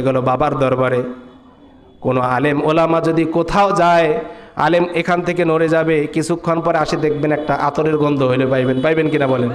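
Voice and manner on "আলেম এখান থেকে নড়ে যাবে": normal talking voice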